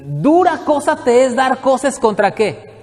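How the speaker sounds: preacher intoning a verse before explaining it; solemn scripture quote. Shown as a demonstration. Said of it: "¿Qué quiere decir esto?".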